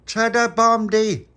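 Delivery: high, loud and slow call to go; rally the gang with a big call; rallying shout. Acted. Telling the kids to head to the bombsite, loud slow call.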